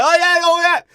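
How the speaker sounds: high, loud mocking yell; exaggerated impression, shouted up high; Mark Wahlberg. Mocking an actor who can't play Texan, high and loud. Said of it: "Mark Wahlberg still, like".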